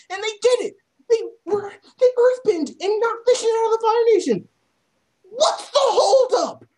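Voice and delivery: high, incredulous ranting; exasperated rant. Real, not acted.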